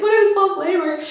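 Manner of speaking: high-pitched mock tearful whine; hammed-up high wail; mock crying. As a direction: imitate yourself crying in a panic. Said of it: "crying my eyes out".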